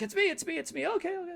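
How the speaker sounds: high, urgent reassurance; calm her down fast; high-pitched mock reassurance; reassuring. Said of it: "He's like".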